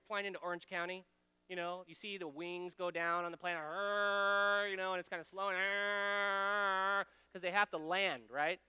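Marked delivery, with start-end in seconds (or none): 3.6-4.7 lazy airplane rumble; imitated plane whir. 5.6-7.1 lazy airplane rumble; imitated plane whir.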